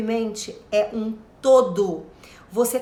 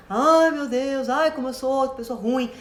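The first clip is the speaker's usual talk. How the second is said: mock self-pity. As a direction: parody someone wallowing in guilt. Mocking guilt-ridden self-pity. (lamento exagerado de autopiedade)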